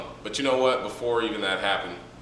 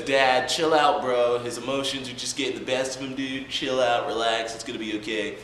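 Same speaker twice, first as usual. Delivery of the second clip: lightly voiced chill dude, higher; easygoing, calming big brother; laid-back brother; 4 semitones higher than usual. Tobirama calming down his dad, casual and higher. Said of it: "he says".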